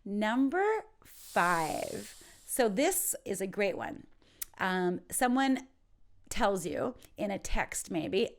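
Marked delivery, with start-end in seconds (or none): none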